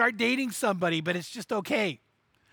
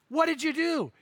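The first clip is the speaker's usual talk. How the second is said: calling out; 7 semitones higher than usual. Retold or imitated. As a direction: call out to a friend across the field. Farmer asks, voiced.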